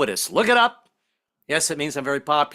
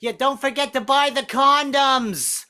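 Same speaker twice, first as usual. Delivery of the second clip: high, slow call from a window; yelling down; 10 semitones higher, slower than usual. Retold or imitated. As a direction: shout it down six floors to the street. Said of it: "yell down six stories".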